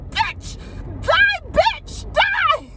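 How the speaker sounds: very high, shrieking curse at the bug; retold mock fury. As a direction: scream it while swatting. Swatting the bug furiously.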